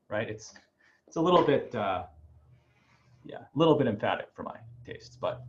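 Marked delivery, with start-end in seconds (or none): none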